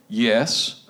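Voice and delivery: low, curt yes with attitude; acted low, unwelcoming sass. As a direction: answer as if bothered. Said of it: "She had an attitude".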